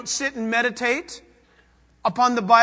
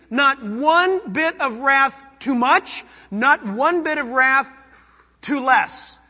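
"one" stressed slowly and louder; emphatic stress on one; 2 semitones higher, 4 dB louder, slower than usual. Real, not acted. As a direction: hammer "Not one bit" slowly. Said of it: "emphatic preaching".

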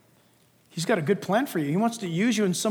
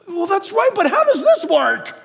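high, louder, indignant whine; mock protest; 10 semitones higher, 4 dB louder than usual. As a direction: protest as if wronged. Believer protests to God.

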